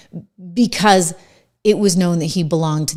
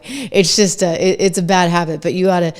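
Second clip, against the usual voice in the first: ordinary delivery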